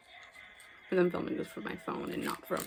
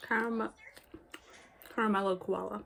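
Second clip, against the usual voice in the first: talking while chewing; words round a bite of chocolate; mouth full; 4 semitones higher, 7 dB quieter than usual. Real, not acted. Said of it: "I'm talking with my mouth full".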